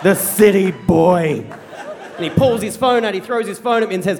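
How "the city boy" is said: retold tirade. Wounded dad disowning his child, slow acted disgust. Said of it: "Dad continues this tirade of abuse".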